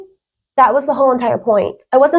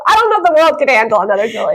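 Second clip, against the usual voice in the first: fast, laughing through the words; 3 semitones higher, faster than usual. Real, not acted. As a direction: laugh it out quickly at yourself. Joking about herself, laughing.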